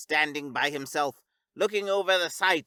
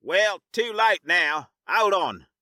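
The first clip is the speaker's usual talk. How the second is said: slow, loud urgent command; 4 semitones higher, 7 dB louder, slower than usual. Acted. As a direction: brace them, it's going to be rough. Captain urgent in crisis.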